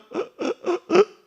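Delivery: high, loud, gulping mock sobs; imitated lingering sobs. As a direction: mimic a grieving daughter's leftover sobs. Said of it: "그런데 딸들은".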